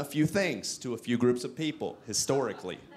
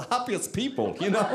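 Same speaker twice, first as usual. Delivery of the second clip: high mock-German lilt; German accent; 8 semitones higher than usual. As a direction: lift it into a comic German accent. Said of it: "that's my German accent".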